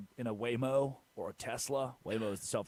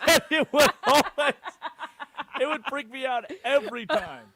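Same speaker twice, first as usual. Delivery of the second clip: laughing; 12 semitones higher, 8 dB louder than usual. Real, not acted. Laughing while recounting.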